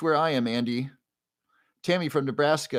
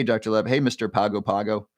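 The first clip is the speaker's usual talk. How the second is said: ordinary talk, nothing marked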